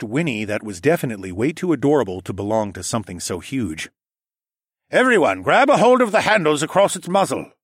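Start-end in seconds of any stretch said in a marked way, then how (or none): 4.9-7.5 loud higher command; ordering. A narrator's reading. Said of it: "Gris ordered".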